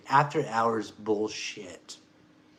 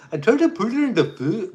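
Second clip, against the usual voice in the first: failed mock Cambodian accent; 9 semitones higher, 6 dB louder than usual. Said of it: "I'm trying to do that, the accent".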